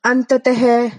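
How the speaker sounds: अतः प्रातः said with a clear breathy ह at the end; visarga as ह. Shown as a demonstration. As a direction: end each word in a puff of ह. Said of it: "विसर्ग है उसको हम है की तरह बोल रहे हैं". (विसर्ग को ह की तरह बोलें)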